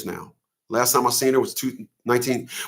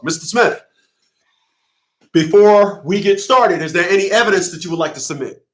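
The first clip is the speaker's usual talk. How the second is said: arrogant judge impression; 5 semitones higher than usual. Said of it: "So this is how the judge talked".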